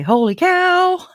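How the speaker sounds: high, slow, loud holy cow; Melanie Gibb impression. Streamer imitating Melanie Gibb's exclamation, high and slow. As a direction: squeal it out slowly, way up high. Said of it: "I'll do like Melanie Gibb does".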